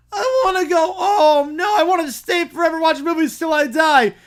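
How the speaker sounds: put-on high, loud wailing child; crying tantrum. Little kid melting down at the premiere, crying shout. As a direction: wail it like a toddler mid-tantrum.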